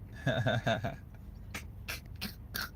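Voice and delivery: breathy, quiet chuckle trailing on; soft laugh of recognition. Real, not acted. Man admitting he's been through it too, laughing. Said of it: "I'm laughing 'cause I've been".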